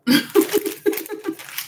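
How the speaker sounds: high airy cackling laugh; high cackle. Real, not acted. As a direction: let out a high breathy cackle. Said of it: "I be cackling all the time".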